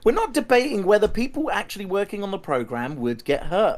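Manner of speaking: exasperated protest. Real, not acted. Exasperated rebuttal in heated debate.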